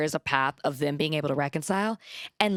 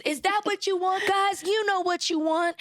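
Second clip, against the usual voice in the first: high, excited crowd-rouser; hyping the crowd; 12 semitones higher than usual. Retold or imitated. Adriana firing up the panel audience, high excited hype. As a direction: pump up the audience like a hype host. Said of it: "started cheering on the audience".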